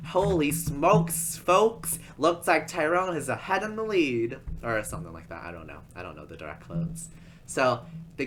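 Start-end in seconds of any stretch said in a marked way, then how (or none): 0.1-4.4 loud, high, excited commentator imitation; race announcer. Announcer tells the folks who leads the race, excited announcer voice. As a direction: call the race with hammed-up astonishment. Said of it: "our announcer, he announces all of this".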